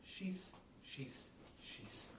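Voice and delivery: "Shees" said softly, falling away down; "shees" quiet and falling; Irish word shees. Shown as a demonstration. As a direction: let "shees" tumble down softly. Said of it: "they went".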